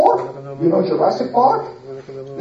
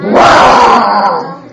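camel roar; 6 dB louder than usual. Acted. Camel roars again.

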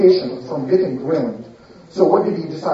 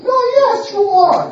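high taunting retort; 13 semitones higher than usual. Acted. Lady taunts him.

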